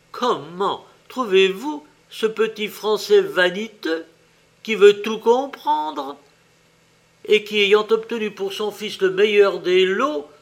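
loud, high, scandalised complaint; indignant outrage. A narrator's reading. Fairy complains to her companions about the shopkeeper, indignant. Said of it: "la fée courroucée".

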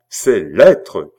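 loud, impassioned outburst; heated cry. A narrator's reading. Queen demands the cardinal's letters, crying heatedly. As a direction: burst out hotly. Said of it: "cria la reine avec emportement".

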